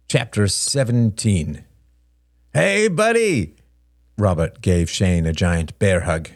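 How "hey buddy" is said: high, drawn-out cheery hail; big, stretched-out hello; hearty greeting. Acted. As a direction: greet a long-missed pal in a raised voice. Brother greets brother with hug.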